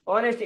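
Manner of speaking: ઓનેસ્ટી said with the h silent, starting on o. Shown as a demonstration. Teacher shows the class ઓનેસ્ટી with silent h. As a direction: say ઓનેસ્ટી as onesty, no h sound. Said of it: "silent હોય".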